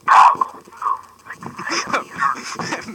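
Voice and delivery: slow, whispery demonic growl. Pretend. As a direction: drag it out like a possessed demon. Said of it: "say it in a demonic voice".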